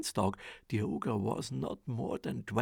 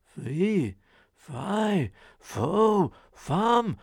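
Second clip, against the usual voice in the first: loud rising chant, each word higher; ogre's roar; 6 semitones higher, 8 dB louder, much slower than usual. Acted. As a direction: build the ogre's call word by word. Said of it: "cried out the ogre".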